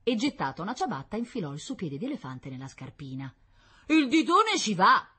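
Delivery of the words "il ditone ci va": high, loud, drawn-out triumph; triumphant. A narrator's reading. Concierge shows the shoe goes on, triumphant announcement. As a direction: crow over your success slowly. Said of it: "annunciò trionfalmente".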